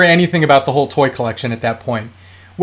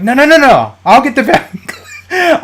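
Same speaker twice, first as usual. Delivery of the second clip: high, loud, rushed protest; panicked no, no, no, blocking the closet door; frantic no; 9 semitones higher, 4 dB louder than usual. Retold or imitated. To girlfriend, frantically stopping her from opening the toy closet, high and loud.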